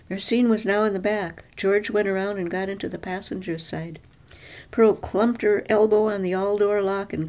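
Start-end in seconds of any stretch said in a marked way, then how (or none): none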